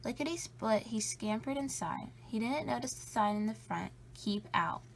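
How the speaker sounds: ordinary delivery, nothing marked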